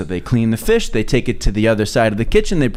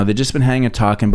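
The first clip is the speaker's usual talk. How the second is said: normal talking voice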